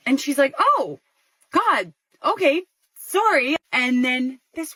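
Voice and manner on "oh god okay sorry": high, awkward little apology; embarrassed apology. Retold or imitated. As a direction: back off awkwardly, pitched up. Embarrassed apology after being denied.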